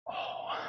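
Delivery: breathy whispered oh and sigh; exhausted sigh. Real, not acted. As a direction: let the breath go out, spent. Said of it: "Let me catch my breath".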